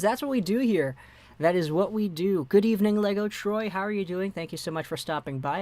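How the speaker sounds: ordinary delivery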